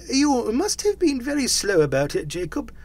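high-pitched, deferential Scrooge figure; respectfully pointing out a fact, pitched high; businesslike yet humble. A narrator's reading. Scrooge querying Jacob's slowness, businesslike and deferential. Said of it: "in a businesslike manner, though with humility and deference".

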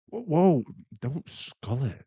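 high, loud "whoa" to stop them; alarmed warning. Acted. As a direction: cut in to halt a friend mid-gulp. Stops listener gulping the drink.

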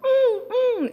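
put-on high whimpering baby hum. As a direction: mimic a baby fussing to go outside. Baby whining and pointing outside.

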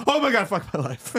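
quick, mock-horrified exclamation; mock mortification. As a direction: blurt it as a teasing replay of panic. Mimics co-host's mortified reaction.

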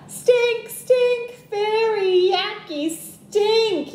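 high, loud, sing-song stink chant; yelled. A narrator's reading. Little brother yells about the skunk's stink. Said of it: "yelled Tate".

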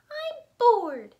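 high, loud squeaky squirrel. Acted. Squirrel complaining to friends, high and loud. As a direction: pitch it way up for the squirrel. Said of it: "said Squirrel".

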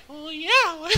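lightly voiced old lady, high and soft; a quick hint of a sweet old lady. Mimicking the chatty old lady at the desk, soft and high. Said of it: "And she's like".

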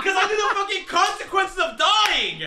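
high, loud protest with laughter; exasperated shout. Real, not acted. Player defends his fear to friend, exasperated shout. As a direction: yell your excuse, half laughing.

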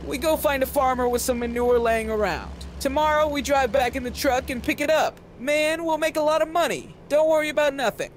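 an overanxious hustler promising easy money; Ponzo's voice. A narrator's reading. Ponzo explaining the plan.

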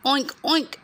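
high oinking grunt; pig oink. Acted. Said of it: "grunted the pig".

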